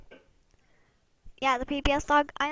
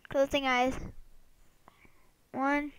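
usual speaking voice

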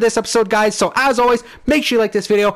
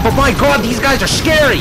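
normal talking voice

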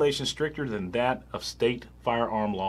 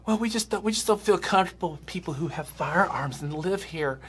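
much higher, prissy mock bureaucrat; mocking official voice; 8 semitones higher than usual. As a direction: sneer it like a fussy council member. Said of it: "the neighborhood commission says".

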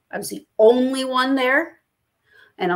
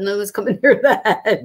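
words breaking into laughter; amused, dissolving into a laugh; laughing joke. Real, not acted. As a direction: start laughing midway through the line. Joking about keeping Lucifer.

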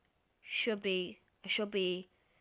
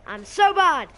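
loud, high cry of frustration; self-mocking shout; 7 semitones higher, 16 dB louder, slower than usual. Real, not acted. Gamer berating own play, loud frustrated cry. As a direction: wail it at full voice.

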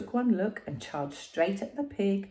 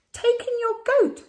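high, louder sage voice; a sly old sage's advice; wise old sage; 8 dB louder than usual. Acted.

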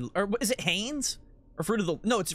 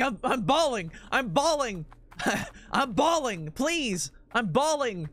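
higher, hammed-up desperate pleading; mock panic; 5 semitones higher, slower than usual. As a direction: plead in pretend panic, stretching each cry. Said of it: "they're texting you in a panic".

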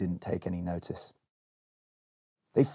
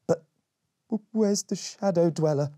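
nervous stammer; 8 semitones higher than usual. Acted. Berry searches nervously.

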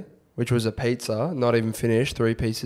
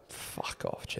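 muttered brush-off; 17 dB quieter, slower than usual. Retold or imitated. Retold brush-off at the bar.

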